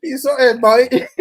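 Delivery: put-on laughing Cockney accent. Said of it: "Your Cockney accent is coming back".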